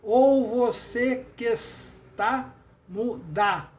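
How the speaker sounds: slow, separated beats with "que está" linked; syllable by syllable. Shown as a demonstration. Teacher counting seven poetic syllables, syllable by syllable. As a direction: scan "Ou você que está muda" beat by beat. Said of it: "Sete sílabas tônicas".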